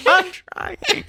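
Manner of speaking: high-pitched laughing protest; helpless laughter. Real, not acted. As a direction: squeak it out while cracking up. Laughing helplessly at failed attempts.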